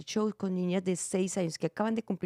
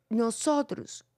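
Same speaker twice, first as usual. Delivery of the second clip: ordinary talk, nothing marked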